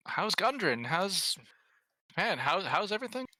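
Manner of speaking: put-on high, warm old official; old man voice. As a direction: greet them as a kindly elder. GM voices Sildar.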